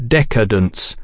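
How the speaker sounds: "decadence" with equal weight on all three syllables; equal syllable stress. A narrator's reading. Tate pronouncing judgement, "decadence" stressed equally on each syllable. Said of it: "giving equal stress to the word's three syllables".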